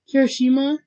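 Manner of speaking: loud, high yelled name; yelling. A narrator's reading. Bakugo yelling for his friend, calling out. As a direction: yell the name as a question. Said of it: "you hear Bakugo yell".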